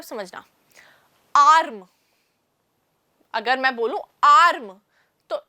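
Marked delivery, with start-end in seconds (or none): 1.4-1.9 "आर्म" with a long, loud drawn-out आ; "आर्म" said loudly as one long syllable; long vowel. Shown as a demonstration. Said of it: "वो लंबा pronounce हुआ". 4.2-4.8 "आर्म" with the आ held long; long-syllable demo of "आर्म"; long vowel. Shown as a demonstration. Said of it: "वो लंबा pronounce हुआ".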